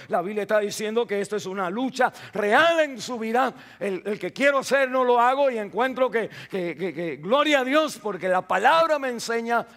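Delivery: alzando la voz con fervor; predicación ferviente. Real, not acted. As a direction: predicar con fuerza creciente. Predicación a toda fuerza. (predicación apasionada)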